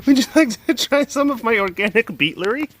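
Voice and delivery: high, sing-song sales pitch; eager vendor. Pretend. Acting out a vendor again.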